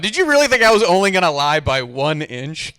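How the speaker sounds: ordinary delivery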